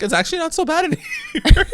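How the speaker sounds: high squeaky mock-cheerful voice laughing through it; a squeaky sing-song, breaking into a laugh; mock cheery. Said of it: "And she's like".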